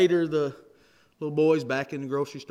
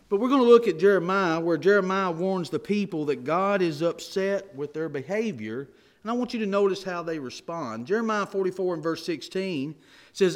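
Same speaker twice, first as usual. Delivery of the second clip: louder, forceful pulpit preaching; preacher pressing the congregation on stubbornness, forceful; 4 dB louder than usual. Acted. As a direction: drive the point home to the congregation. Preacher builds at full force.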